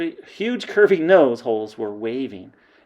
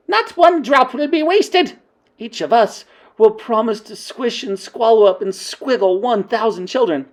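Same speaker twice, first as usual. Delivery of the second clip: witch queen's vow; 10 semitones higher, 8 dB louder than usual. Acted. Grand High Witch rallying her witches, high and loud. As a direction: promise it with relish.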